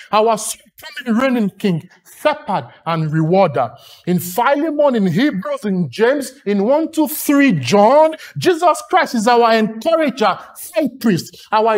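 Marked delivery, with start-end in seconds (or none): none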